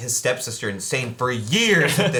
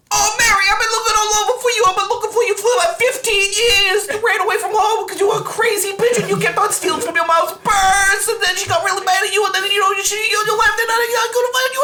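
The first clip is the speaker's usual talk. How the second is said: much higher, louder cartoon Italian dad, rambling; space Italian dad; 5 dB louder than usual. Long-lost dad reunited with Mary, high, loud and rambling. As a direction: squeal it out like a long-lost papa. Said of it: "he's like space Italian".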